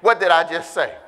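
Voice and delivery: loud, rising preacher's shout; preaching at full force. Acted. Preacher firing up the church, at full shout. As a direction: whoop it up to the congregation.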